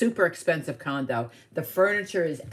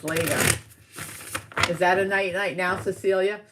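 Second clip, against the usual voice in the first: ordinary talk, nothing marked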